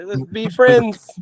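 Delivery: high, slow, syrupy mock sweetness; fake friendly offer. Offering friendship to a hater, high and syrupy.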